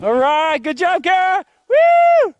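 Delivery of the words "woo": loud, high whoop; cheering whoop. Real, not acted. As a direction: whoop them down the hill. Cheering a sledder on.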